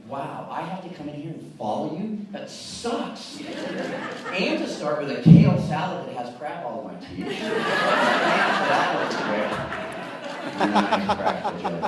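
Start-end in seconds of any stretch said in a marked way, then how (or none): 2.3-11.9 laughing through the words, louder. Real, not acted. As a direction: joke while cracking up. Joking about following speakers, laughing.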